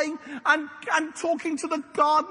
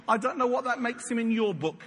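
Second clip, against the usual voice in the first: ordinary talk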